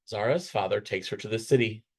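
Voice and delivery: ordinary delivery, nothing marked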